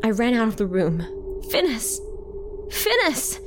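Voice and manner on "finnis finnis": high call, climbing on the repeat; urgent shout. A narrator's reading. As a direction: call down the hall, pitched up without extra volume. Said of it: "I shouted as I ran".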